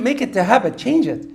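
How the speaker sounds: ordinary talk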